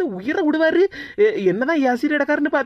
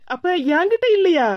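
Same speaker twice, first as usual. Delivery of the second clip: anxious surprise; 5 semitones higher than usual. Acted. Wife checking her husband isn't angry at her, surprised. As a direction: ask it half-relieved, half-afraid.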